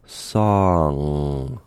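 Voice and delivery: song hummed through the nose on the ng; nasal song. Shown as a demonstration. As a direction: slide from ah into a long nasal ng in song. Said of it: "the vibrations should move from your mouth to your nose".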